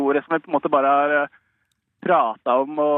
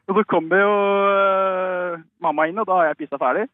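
usual speaking voice

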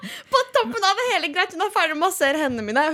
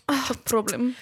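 hushed, unvoiced imitation; whispered beautician imitation; whispered mimic; 3 semitones lower, 4 dB quieter, slower than usual. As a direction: breathe it like a verdict. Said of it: "så på huden til Sosh bare".

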